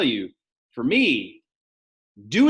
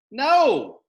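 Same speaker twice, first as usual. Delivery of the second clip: emphatic no; 12 semitones higher, 7 dB louder than usual. Shown as a demonstration. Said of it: "emphatic answer".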